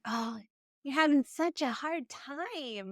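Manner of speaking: high, slow, syrupy pity; condescending sympathy. Acted. Parent talking down to an upset child, condescending sympathy. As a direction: coo it with drawn-out fake sympathy. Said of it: "Oh, it's so condescending".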